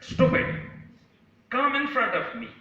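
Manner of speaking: put-on storyteller's cross little boy; a little angry. Little boy challenges the hidden voice, a little angry. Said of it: "The boy was a little angry".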